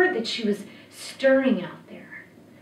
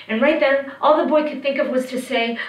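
ordinary delivery

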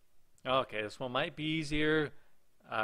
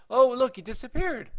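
high, loud exclamation; surprised outburst; 11 semitones higher, 11 dB louder than usual. Real, not acted. Player to viewers as the game figure vanishes, surprised. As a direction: call out the sudden discovery.

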